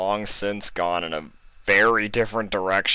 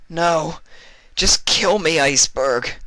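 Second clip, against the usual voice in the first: higher, louder begging; desperate plea; 11 semitones higher, 6 dB louder than usual. Acted. Vinyl pleading with Iceberg to spare Fluttershy, desperate. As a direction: beg him to take you instead.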